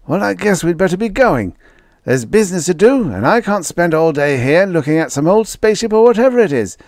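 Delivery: put-on high, bright-eyed boy showing off his plan; a young boy playing it virtuous; eager schoolboy voice; boyish Red voice. A narrator's reading.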